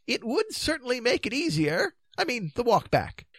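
high, mildly hopeful king; dithering king. Acted. King defending his plan, high and dithering. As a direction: offer it gently in a high voice.

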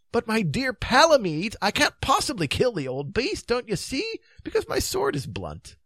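put-on high, protesting old king; dithering king. King excusing himself to Palomides, high and dithering. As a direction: plead helplessly in a high voice.

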